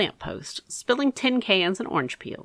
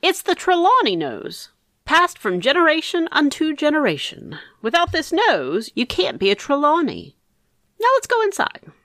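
mock-grand uncle, higher and a bit louder; a hint of pompous pride, pitched up; Uncle Harvey; 8 semitones higher, 3 dB louder than usual. A narrator's reading. Uncle grandly praising family nose.

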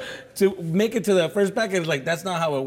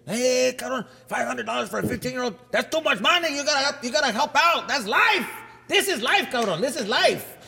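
uncle preaching; 6 semitones higher than usual. Retold or imitated. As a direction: lecture about life, pitched up. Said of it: "And he's like".

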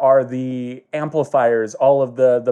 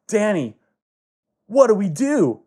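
high, anxious character voice; high-pitched helpless question; worried plea; 7 semitones higher than usual. Acted. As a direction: plead with a partner for a plan. Ninja Brian speaks, worried.

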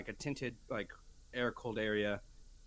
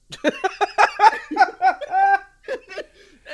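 big shrieking burst of real laughter; loud cackling laugh; 19 dB louder than usual. Real, not acted. Cracking up at the memory, loud cackling laugh. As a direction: let a huge helpless laugh rip out. Recalling the "What tribe" moment.

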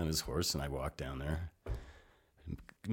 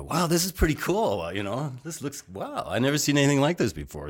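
amazed wow; 9 semitones higher, 4 dB louder than usual. Retold or imitated. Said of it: "and, uh, I go".

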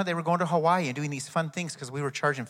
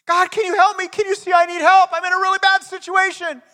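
desperate cry for help; 15 dB louder than usual. Acted. Climber dangling from a cliff cries to God, very high panicked shout. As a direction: scream it up to heaven, hanging on for life.

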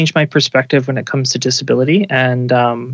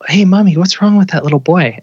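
put-on child voice; 5 semitones higher than usual. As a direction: voice a blunt little kid asking mom. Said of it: "they'll ask their parents all sorts of things like".

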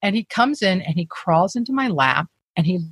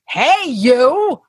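imitated hearty call, louder and higher; loud, high-pitched greeting in a retold voice; 6 semitones higher, 6 dB louder than usual. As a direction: hail someone you spotted, raised voice. Acted stranger calling out to her.

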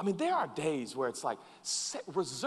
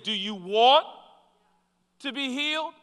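higher, louder, gently probing question to the paralyzed man; Jesus asking; 9 semitones higher, 8 dB louder than usual. Retold or imitated. Jesus asking the paralyzed man, higher and louder. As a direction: lift "Do you want" high and loud, rising on "want". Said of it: "He said".

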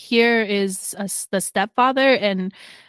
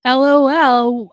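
"L O L" spelled out slowly and louder, each letter stretched; a teasing, exaggerated "L O L"; mocking drawn-out LOL; 5 semitones higher, 7 dB louder, slower than usual. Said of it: "But he's like".

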